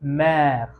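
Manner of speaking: "मैर" said as French mère, with an open è; French pronunciation demo. Shown as a demonstration. Teacher giving the French word for mother, careful pronunciation. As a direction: model "मैर" with the open è held clearly. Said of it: "तो sound हो जाएगा".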